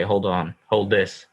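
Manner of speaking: usual speaking voice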